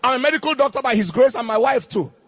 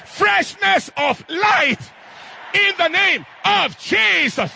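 preacher crying out the blessing; rising prophetic shout; 6 semitones higher, slower than usual. Acted. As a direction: build it to the climax. Repeated prophecy, climax.

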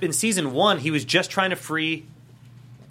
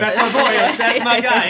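higher and louder, laughing through it; laughing proud dad; 5 semitones higher, 7 dB louder, slower than usual. Retold or imitated.

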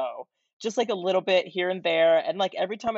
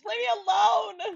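shout. Acted. Clip of Greek Mom shouting.

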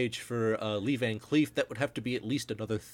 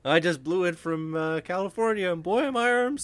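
put-on milk the punchline like an old vaudevillian; hack comedian; 8 semitones higher, 5 dB louder than usual. Co-host adding a bad joke, a hammed-up stand-up comic punchline.

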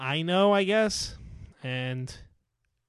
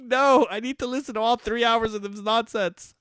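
retold protest; 7 semitones higher than usual. Retold reply to wife, amused.

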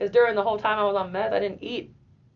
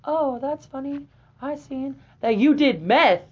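mom's loud, slow, sarcastic call-out; slow, pointed, raised voice; 7 semitones higher, 8 dB louder, slower than usual. Retold or imitated. Mother calls her out in the restaurant, loud and slow. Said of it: "she got loud with me".

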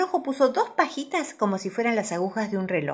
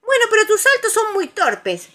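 high, loud cross reprimand; scolding; 8 dB louder than usual. A narrator's reading. Conejo to clumsy Tiger, scolding. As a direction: tell him off sharply. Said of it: "lo regañó Conejo".

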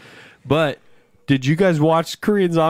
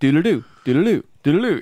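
mock gibberish; 3 semitones higher, much faster than usual. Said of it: "It wasn't like".

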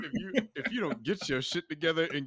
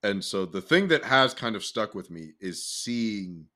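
sincere reflection; 10 semitones lower than usual. Real, not acted.

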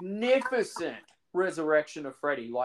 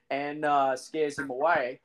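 normal talking voice

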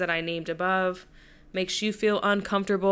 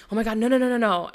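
retold panic; 3 semitones higher, 6 dB louder, faster than usual. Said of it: "I was like".

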